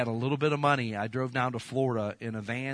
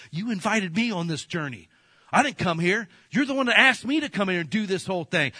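higher and louder, indignant protest; fired up; 7 semitones higher, 6 dB louder than usual. Retold or imitated. Preacher retelling his protest to the timeshare manager, indignant. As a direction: push back hard at the saleswoman. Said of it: "I was fired up".

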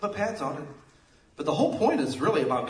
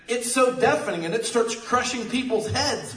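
usual speaking voice

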